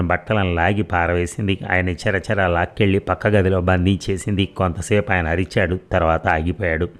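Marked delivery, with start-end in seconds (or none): none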